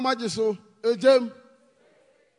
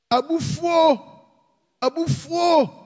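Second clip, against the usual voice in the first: called out; 5 semitones higher than usual. Real, not acted. Preacher naming sins.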